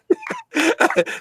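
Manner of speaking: short high-pitched laugh. Real, not acted.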